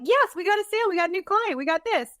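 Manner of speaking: bright, high, eager announcement; excited client voice. Retold or imitated. Client cheering over a new sale, excited.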